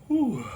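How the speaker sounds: sharp high whew of burning pain. Real, not acted. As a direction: blow it out high, mouth on fire. Reacting to a hot pepper.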